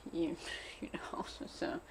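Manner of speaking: breathy whispered "you know" with a laugh; whispered aside. Real, not acted. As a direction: drop to a whisper, half laughing.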